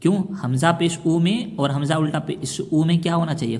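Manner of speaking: normal talking voice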